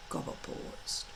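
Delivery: ordinary delivery, nothing marked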